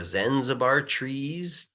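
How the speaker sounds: usual speaking voice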